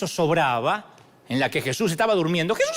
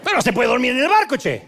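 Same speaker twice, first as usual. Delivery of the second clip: grumbling complaint; 6 semitones higher, 4 dB louder than usual. Acted. Grumpy woken Jesus complaining.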